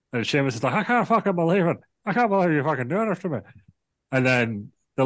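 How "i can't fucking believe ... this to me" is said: high, hammed-up Irish disbelief; mock Irish whine. Sheamus voiced in retold promo.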